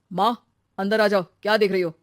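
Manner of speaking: calling out. Acted. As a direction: call her indoors. Son calls mother indoors. (माँ को आवाज़ लगाते हुए)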